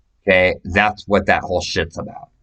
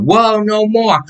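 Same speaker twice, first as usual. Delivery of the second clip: much higher, louder whoa no more; mock pleading rival; 11 semitones higher, 3 dB louder, slower than usual. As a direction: play the woman backing down, high. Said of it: "she said".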